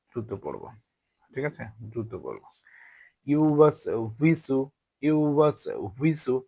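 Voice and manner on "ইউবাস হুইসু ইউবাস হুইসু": "ইউবাস হুইসু" with a rounded w, slowly; rounded-lip waw. Shown as a demonstration. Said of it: "ঠোঁট গোল করে". ("ইউবাস হুইসু"-তে ঠোঁট গোল করে ওয়াও)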